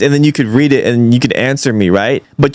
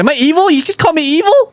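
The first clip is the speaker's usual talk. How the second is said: high-pitched, indignant questioning; mock offended; 14 semitones higher, faster than usual. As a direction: act affronted, voice jumping up high.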